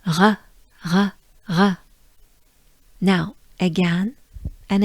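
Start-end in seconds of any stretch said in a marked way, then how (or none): 0.1-1.8 "R" as a breathy French throat sound. Shown as a demonstration. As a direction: exhale the "R" with the tongue kept down. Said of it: "it sounds like this".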